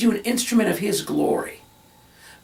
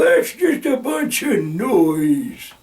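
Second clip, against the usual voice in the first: slow, scornful brush-off; dismissive scoff; 5 dB louder, much slower than usual. Retold or imitated. Preacher mocking a critic of contemporary music, slow and scornful. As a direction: wave it away as noise. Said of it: "somebody else might go".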